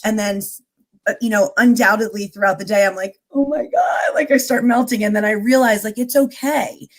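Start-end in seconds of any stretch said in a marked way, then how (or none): 3.3-5.0 higher-pitched acted dismay; mock overwhelm. Guest reenacts her daily meltdown, higher mock dismay. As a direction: play at melting under the day. Acting out melting during the day.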